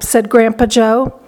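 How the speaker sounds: ordinary delivery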